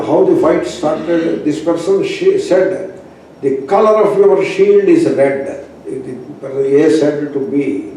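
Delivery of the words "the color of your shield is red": higher, louder accusing challenge; challenging tone. Retold or imitated. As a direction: throw it at him like a provocation. Soldier A taunts soldier B.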